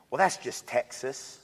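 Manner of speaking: slow, lightly put-on Texas drawl. Mocking supporters' excuse, slow mock Texas drawl. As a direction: stretch it out in a mock Texan drawl. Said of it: "other folks I've heard actually say".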